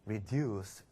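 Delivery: soft, low, graceful word; elegant. Shown as a demonstration. Teacher models the opposite word for students, elegantly. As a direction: let the word glide out softly and elegantly. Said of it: "우아하게".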